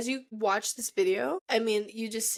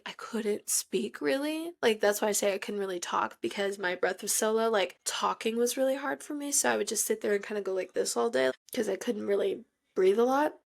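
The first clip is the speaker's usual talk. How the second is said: ordinary talk, nothing marked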